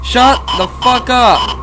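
slow, high, loud yell; furious shout. Real, not acted. Silencing someone mid-game, furious shout. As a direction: stretch out each word at the top of the voice.

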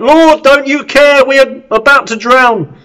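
high, loud cry for help in a storm; panicked cry. Retold or imitated. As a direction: call out in fear of drowning. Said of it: "the disciples called out".

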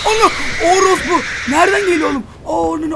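high, loud startled scream; frightened shout of surprise; scared scream. Real, not acted. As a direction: yelp in real fright at the jump scare. Jump scare in horror game.

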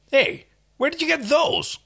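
high, outraged protest. Acted. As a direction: squeal it in protest. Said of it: "Crichton protested".

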